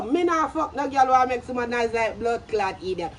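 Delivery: usual speaking voice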